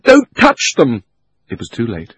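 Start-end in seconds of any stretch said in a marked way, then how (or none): 0.0-1.0 loud urgent shout, pitched up; boy shouting in alarm. Acted. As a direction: yell a warning at her. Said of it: "he shouted".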